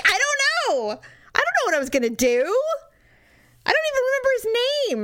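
high-pitched, exasperated mock protest; retold exasperation. Arguing back at the jealous boyfriend, mock exasperated. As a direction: throw it back as a squeaky retort. Her retort in retold argument.

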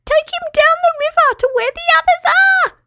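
very high, straining squeaky voice; tiny crayfish straining to squeak; strained squeak. Acted. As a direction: squeak it out while clinging on. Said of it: "squeaked Petruchio in a strained voice".

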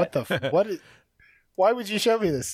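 laughing while complaining; laughing protest. Real, not acted. Reacting to the gross photo.

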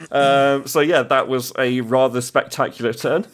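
ordinary delivery

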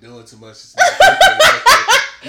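laughing through the words; can't stop laughing while saying it; words spilling out between laughs. Real, not acted. Laughing while mocking hookah.

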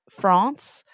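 France said with a long, open "ah" vowel, like frahns; 1 other way of saying France. Shown as a demonstration. Host introducing guest from Paris, France, says France with an open ah. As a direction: say "France." with a broad a. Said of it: "I say France because I'm Australian".

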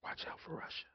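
ominous whisper; whispered warning. Retold or imitated. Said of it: "My granddad used to say".

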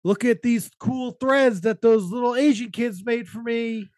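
slow, high, showy boast; bragging party guy. Retold or imitated. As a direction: show off your new clothes. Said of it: "you're like".

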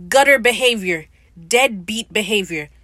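loud disgusted verdict, the second phrase slowed down; indignant call-out. Real, not acted. Judging the runaway husband to viewers, loud and disgusted. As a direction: call it out loud like a scandalised friend. Scolding a character who abandoned wife.

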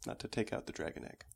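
hushed whisper, secretive. Real, not acted. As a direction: whisper so no one overhears. Hiding the egg talk.

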